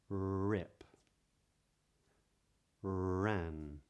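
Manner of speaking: each word spoken slowly with a curled British R; modern British accent. Shown as a demonstration. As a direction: model each R word as a clear drill. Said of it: "you hear it in words like these".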